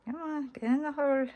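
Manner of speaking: high, soft coaxing under breath; fiddly focus, coaxing it in; threading a needle. Real, not acted. Coaxing ring onto earring top.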